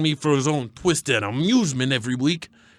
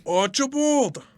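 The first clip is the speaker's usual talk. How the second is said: calling out; 11 semitones higher than usual. Acted. Calling out a name.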